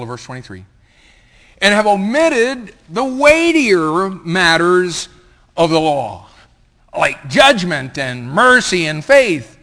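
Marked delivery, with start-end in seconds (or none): 1.6-9.6 loud, high, drawn-out proclamation; thundering scripture reading. Acted. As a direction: preach the verse at full force, slowly. Preacher reads scripture at full force.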